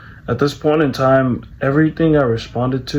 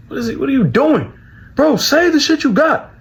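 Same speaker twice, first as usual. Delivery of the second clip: high-pitched exasperated yell, acted out; angry demand; 3 dB louder than usual. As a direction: imitate a furious brother demanding an answer. Brother's angry demand retold.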